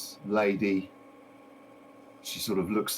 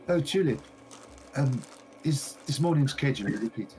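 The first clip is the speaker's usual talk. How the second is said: higher, eager shopkeeper voice; gushing shopkeeper lady; 6 semitones higher than usual. Acted. A game master voicing the shopkeeper greeting a visitor, high and eager. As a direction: play the delighted lady greeting her customer.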